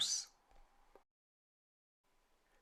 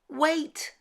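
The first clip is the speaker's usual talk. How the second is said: very high, urgent squeak; squeaky mouse; 5 dB louder than usual. Acted. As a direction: stop her with a tiny squeal. Mouse pleads before being eaten.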